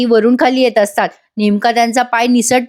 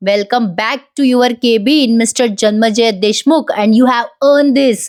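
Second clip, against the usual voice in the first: slow, measured presentation; lightly acted proud father, very slow; ceremonial welcome; much slower than usual. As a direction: announce it grandly, drawing out each phrase. Father ceremonially presents son's cabin.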